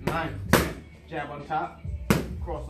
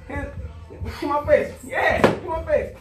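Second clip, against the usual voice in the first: loud, high, pumped-up pad calls; hype coaching; 8 semitones higher, 4 dB louder than usual. Real, not acted. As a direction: fire up the fighter with every call. Coach hyping up pad work.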